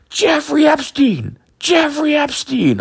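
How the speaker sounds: very high, drawn-out mock yell; imitated yelling. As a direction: mimic muffled yelling heard upstairs, stretched and high. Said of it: "All I can hear is you yelling".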